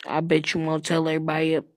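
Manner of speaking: usual speaking voice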